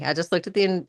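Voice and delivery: ordinary talk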